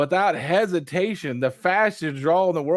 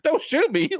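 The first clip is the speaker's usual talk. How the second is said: high, laughing plea of a scared alien; laughing mock plea; 12 semitones higher than usual. Four-armed alien begs for his life, laughing and high. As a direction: squeak it out through laughter.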